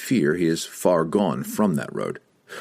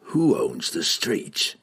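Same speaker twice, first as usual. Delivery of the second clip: cold assassin voice; 3 semitones higher, much slower than usual. Acted. Entreri asks.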